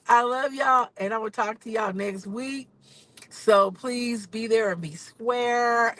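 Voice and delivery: normal talking voice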